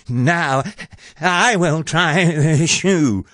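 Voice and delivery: high, slow ugly sister voice; yelling. A narrator's reading. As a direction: yell it slowly as a pushy sister. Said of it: "who yelled".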